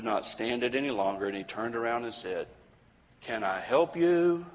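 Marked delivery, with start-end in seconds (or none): 3.2-4.5 higher, slow, snippy question; too sharp, more than intended; mean. A narrator's reading. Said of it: "with a little too much meanness".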